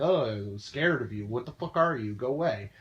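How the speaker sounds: imitating someone shrinking back from a creep; mock recoiling fear. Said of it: "like, recoil".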